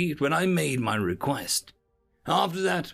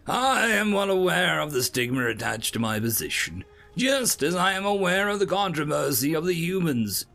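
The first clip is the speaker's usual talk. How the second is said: firm, higher-pitched official retort; sturdy; 7 semitones higher, 3 dB louder than usual. A narrator's reading. Observer answering his assistant's reminder, sturdy. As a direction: stand your ground, mildly raised. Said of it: "I say sturdily".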